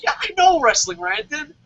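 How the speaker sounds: high, fast, put-upon whine; mock whiny reply. Answering the rival reviewer in a mock whiny voice. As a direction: play the wounded victim. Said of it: "You're like".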